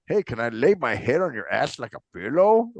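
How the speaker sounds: low goofy mocking voice; mock the guy's pickup line; mocking Sal voice. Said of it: "my brother was making fun".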